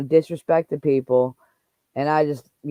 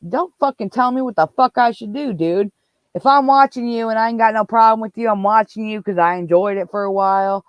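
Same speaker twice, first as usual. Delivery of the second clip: louder, higher indignant outburst; heated, raised-voice telling-off; angry retort; 5 semitones higher, 5 dB louder than usual. Real, not acted. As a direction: cut in hotly and push back. Cuts off mimicry with angry retort.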